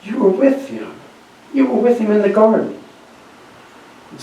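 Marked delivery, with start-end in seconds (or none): 0.0-2.8 higher-voiced accusing servant woman; a hint of a servant woman's accusation. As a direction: point the finger, lightly voiced woman. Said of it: "a servant woman said".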